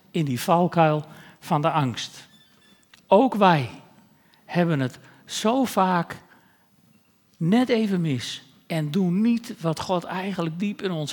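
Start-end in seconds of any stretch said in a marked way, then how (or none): none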